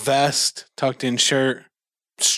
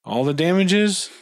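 surprised question; 6 semitones higher than usual. Acted. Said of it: "he looks around and he says".

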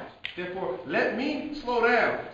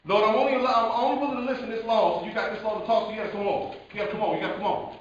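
loud, higher, rushing demand; impatient hurrying; 4 semitones higher, 6 dB louder, faster than usual. Acted. Believer rushing the Lord along, loud and impatient. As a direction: press the listener to hurry up.

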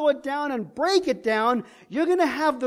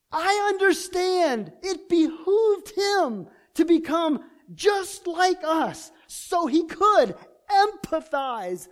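rising, louder preacher's climax, drawn out; preaching at full force; 3 semitones higher, 4 dB louder than usual. Acted. Preacher urging the congregation to see Jesus, fervent and loud. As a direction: preach it to the crowd, building fervor.

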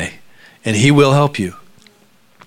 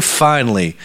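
ordinary talk, nothing marked

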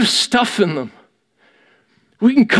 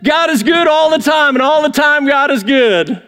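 higher, loud, sing-song stock answer; mock pious reply; 7 semitones higher, 3 dB louder than usual. Church member giving the expected answer, hollow and loud. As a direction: parrot the churchy phrase with hollow cheer.

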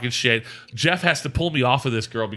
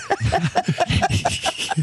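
high wheezy snicker; imitated laughing; 10 semitones higher than usual. Imitating Al standing over the bed, wheezy snickering. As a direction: snicker breathily like a tipsy friend. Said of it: "I hear him laughing just like that".

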